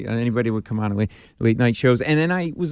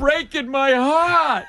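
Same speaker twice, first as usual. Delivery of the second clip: slow, much higher mock lament; mock disappointed parent; 4 dB louder, much slower than usual. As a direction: ham up a heartbroken parent, drawn out. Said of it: "You say it like my disappointed parents".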